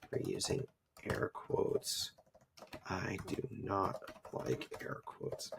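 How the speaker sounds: hushed, slow whisper, very soft. Pretend. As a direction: drop to a slow, deliberate whisper. Mock apology in hushed voice.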